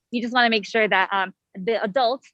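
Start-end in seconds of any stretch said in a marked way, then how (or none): none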